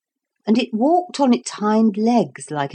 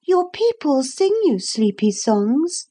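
put-on little girl voice; 9 semitones higher than usual. Lucy's voice in the story.

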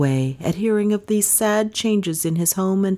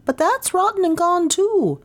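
much higher, piping villager voice; high-voiced villager; 11 semitones higher than usual. A narrator's reading. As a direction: answer in a high character voice.

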